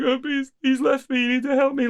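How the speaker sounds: mock upset friend. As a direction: play a friend calling in tears, half-hearted. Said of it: "be like".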